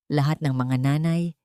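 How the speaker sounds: plain reading voice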